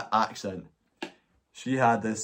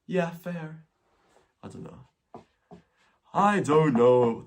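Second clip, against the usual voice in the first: a light, higher mock-posh "Yeah, fair"; drifty posh accent; 5 semitones higher, slower than usual. Vlogger imitating the posh flatmate once more. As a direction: toss it off, vague and distant. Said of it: "it's a sort of drifty".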